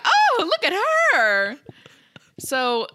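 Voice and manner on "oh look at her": high, loud cooing over a pet; hammed-up adoring squeal; gushing admiration. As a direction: gush in mock awe, voice swooping up. Acts crowd admiring the owl.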